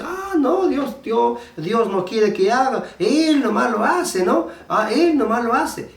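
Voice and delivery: high-pitched mock whining objection; childish complaint. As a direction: protest like a kid who feels denied. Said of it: "somos como niños que pensamos así".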